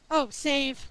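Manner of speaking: normal talking voice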